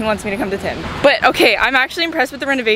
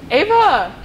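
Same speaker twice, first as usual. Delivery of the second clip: calling out; 7 semitones higher, 4 dB louder than usual. Real, not acted. Calls out to friend.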